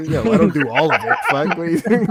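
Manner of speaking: loud, laughing, indignant painter; laughing mock painter. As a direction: bark it out through laughter.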